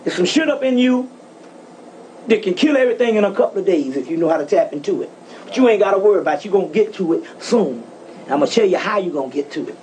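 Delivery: usual speaking voice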